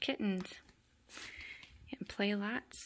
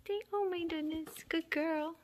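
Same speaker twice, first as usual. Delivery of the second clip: high pet-talk praise; cooing praise; 9 semitones higher than usual. Real, not acted. Praising a kitten at play, high cooing. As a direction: coo high over a kitten.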